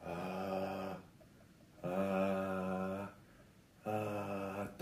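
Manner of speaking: very soft, airy low "ah" without resonance; breathy weak low voice. Shown as a demonstration. Teacher imitates people whose low notes won't ring, breathy and weak. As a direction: let the low note leak away feebly in breath. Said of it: "なんとなく息っぽくなって、".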